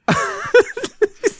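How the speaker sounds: a high burst of laughter. Real, not acted. Laughs at his nephew's quote. (hohes Auflachen)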